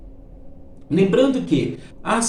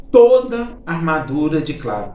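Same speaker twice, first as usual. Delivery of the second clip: slow, louder emphatic statement; emphatic rule; 4 dB louder, slower than usual. Shown as a demonstration. Teacher flagging a key rule for students, slow and emphatic. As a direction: announce the rule slowly and firmly. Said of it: "presta bem atenção no que eu vou falar".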